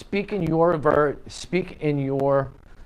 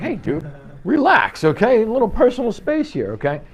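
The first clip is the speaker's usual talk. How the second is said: mock protest; 8 semitones higher than usual. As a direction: play a crowded client asking for room. Crowded client reacting, acted.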